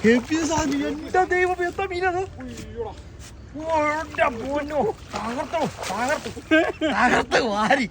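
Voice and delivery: high, thrilled exclamations over a big catch; real burst of joy, voice jumping up; excited. Real, not acted. Big fish just landed.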